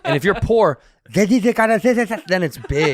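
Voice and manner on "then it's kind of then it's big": slow, high demo of a fat lisped S in "big". Shown as a demonstration. Showing how poor Spaniards lisp, slow and laughing. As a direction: drag out the S sound, laughing. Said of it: "the less S you have".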